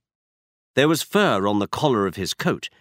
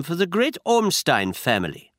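put-on German accent; 6 semitones higher than usual. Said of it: "He had a strong German accent".

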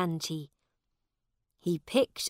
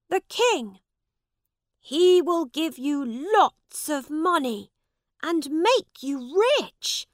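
begging; 11 semitones higher, 10 dB louder than usual. Acted. Tired prince begs the man for help, pleading. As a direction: plead with him to take you home. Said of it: "begged the prince".

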